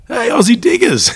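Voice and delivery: a high, loud put-on Aussie greeting, hammed up; mock Aussie accent. Local man hailing Aussie soldiers, in a high, loud mock Aussie accent. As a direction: play the local guy hailing the diggers in broad Aussie slang. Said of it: "he had a full-on accent".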